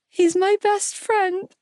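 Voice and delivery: high, slow, sobbing wail; breaking down in tears; crying. A narrator's reading. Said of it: "I cried".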